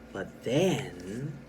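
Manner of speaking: THEN drawn up and left hanging; suspenseful pause. Shown as a demonstration. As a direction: let THEN trail into a cliffhanger. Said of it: "second line had the dot".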